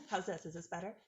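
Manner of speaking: very quiet and distant on speakerphone; muffled speakerphone. Real, not acted. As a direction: sound muffled, far from the mic. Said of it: "No, that's even worse".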